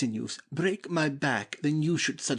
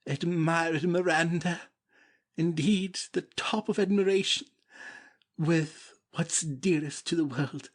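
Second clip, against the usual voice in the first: rising, swept-away admiration; higher and lifted, carried away by her name; rapturous exclamation; 5 semitones higher than usual. Acted. Prince to Miranda, rapturous exclamation.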